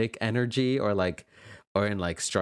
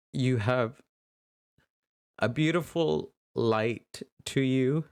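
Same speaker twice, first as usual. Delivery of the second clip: normal talking voice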